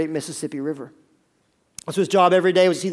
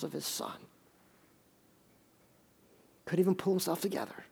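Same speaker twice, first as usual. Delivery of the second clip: hushed narration; 2 semitones lower, 8 dB quieter than usual. A narrator's reading. Soft narration of father's grief.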